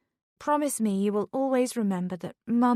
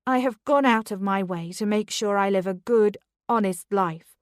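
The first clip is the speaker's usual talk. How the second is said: loud, defiant protest; mother raising her voice at the headmistress; raised voice; 2 semitones higher, 5 dB louder than usual. Acted. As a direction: stand your ground, raise your voice. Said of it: "can you please keep your voice down".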